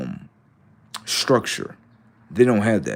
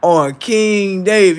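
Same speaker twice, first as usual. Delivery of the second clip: mock oath, higher; 7 semitones higher, 4 dB louder, slower than usual. Mocking chat swear.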